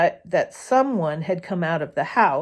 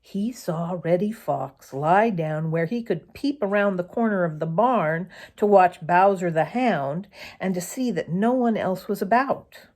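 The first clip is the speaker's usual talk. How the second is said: ordinary delivery, nothing marked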